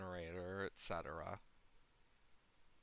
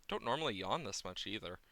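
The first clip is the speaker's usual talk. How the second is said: ordinary talk, nothing marked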